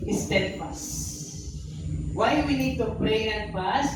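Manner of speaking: slow, drawn out, each word weighed; slow emphatic preaching. Acted. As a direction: lean on steadfast slowly. Preacher stressing key point. (dahan-dahan at mariing pangangaral)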